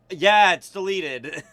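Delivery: slow, loud retold assurance; drawn-out mock compliance; retold reply. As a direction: stretch it out as a placating answer. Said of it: "I'm like".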